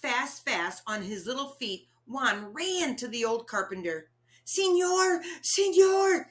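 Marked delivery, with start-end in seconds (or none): 4.4-6.3 high, breathless plea; out of breath from running; wheezing. A narrator's reading. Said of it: "he wheezed".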